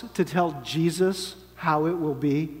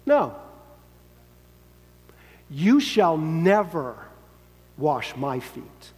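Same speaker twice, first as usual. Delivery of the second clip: Peter flatly refusing, every word weighed; adamant refusal; slower than usual. Acted. Peter refusing to let Jesus wash his feet, stubborn and slow. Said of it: "said Peter".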